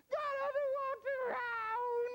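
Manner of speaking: hammed-up bawling little kid, drawn out; high, loud mock tearful whine; crying child. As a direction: wail the answer slowly, very high and loud. Acted crying child answers agent.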